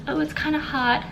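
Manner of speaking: high put-on voice, airy and hot; mock dramatic. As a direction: play it up, fanning yourself. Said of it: "I can be like".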